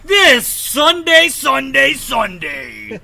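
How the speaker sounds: high, loud, slow monster truck announcer. Pretend. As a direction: boom it like a monster truck promo. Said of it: "be like the monster truck guy".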